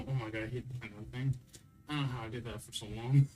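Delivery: ordinary talk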